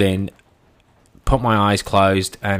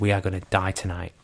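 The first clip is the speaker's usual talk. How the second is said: quiet; 2 semitones lower, 8 dB quieter, faster than usual. Retold or imitated. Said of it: "I said".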